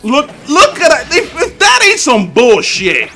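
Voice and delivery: real laughing outrage at the screen; laughing disbelief. Real, not acted. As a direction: laugh at the bullshit move.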